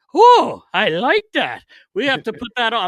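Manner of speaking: high, loud whoop, then eager; imitated delight. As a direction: burst out as the thrilled goalie. Imitating goalie's delighted reaction.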